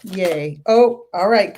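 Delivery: normal talking voice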